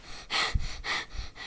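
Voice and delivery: long breathy gasp for air; exhausted sigh. Retold or imitated. Boy out of breath from running sighs. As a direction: let out a worn-out sigh. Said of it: "So I'm like".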